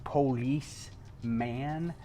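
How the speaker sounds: very slow, drawn-out puzzled replicant question; imitating Rutger Hauer. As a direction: stretch out "Police" very slowly, wondering at the word. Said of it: "And Rutger Hauer says".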